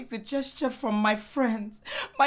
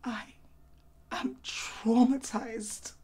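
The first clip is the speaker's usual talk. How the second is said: low, very slow, halting; stricken; 5 semitones lower, 4 dB quieter, slower than usual. Acted. Wife confronting husband about her trauma, stricken and slow. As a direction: drag it out as if wounded.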